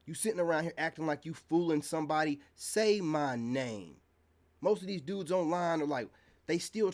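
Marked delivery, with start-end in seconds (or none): 2.5-4.0 drawn out slow and firm again, same weight; slow taunt. Real, not acted. Repeating it to his critics, a slow drawn-out taunt. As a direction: drag each word out like a dare.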